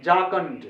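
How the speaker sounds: झारखंड as "jaa-kund", falling; झारखंड unaspirated, plain j and k; English pronunciation. Shown as a demonstration. Teacher tells students what to say, झारखंड as "jaa-kund". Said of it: "वहां पर मैं बोलना चाहिए".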